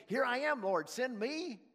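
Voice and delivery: eager answer. Acted.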